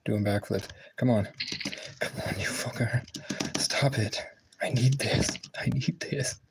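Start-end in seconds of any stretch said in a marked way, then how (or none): 2.0-6.4 high pleading whisper; desperate whisper. Real, not acted. Gamer begging the game in a high whisper. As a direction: beg the game under your breath. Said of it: "pleading whisper at the game".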